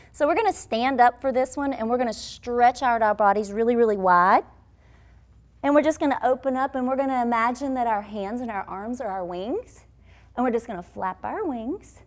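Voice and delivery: ordinary talk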